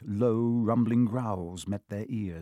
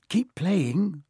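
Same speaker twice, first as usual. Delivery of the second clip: higher, urgent caution; warning; 7 semitones higher than usual. A narrator's reading. Ron urges Harry as they creep past the dog, warning. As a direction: warn with a raised pitch. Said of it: "Ron warned Harry".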